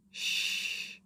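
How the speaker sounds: soft unvoiced shh; quiet shushing baby; whispered shush. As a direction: hush everyone.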